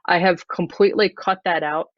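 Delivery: usual speaking voice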